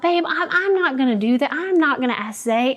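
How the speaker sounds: high-pitched, insistent refusal; retold protest. Wife balking at her husband's suggestion, high protest.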